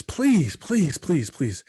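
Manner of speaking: high pleading please, fading off; begging coach. Retold or imitated. Player begging his coaches to play again, pleading. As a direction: beg the coach to let you back. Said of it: "I begged the coaches".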